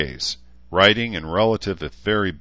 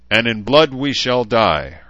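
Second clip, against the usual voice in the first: ordinary delivery, nothing marked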